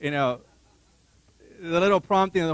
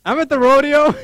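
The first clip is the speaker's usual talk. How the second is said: high, loud, sheepish reply; sheepish admission; 9 semitones higher, 10 dB louder than usual. Retold or imitated. Husband confessing to wife on the phone, sheepish. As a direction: own up awkwardly, pitched high.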